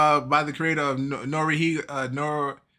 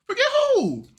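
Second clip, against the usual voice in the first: mock surprise; 3 dB louder than usual. Mock amnesia reaction.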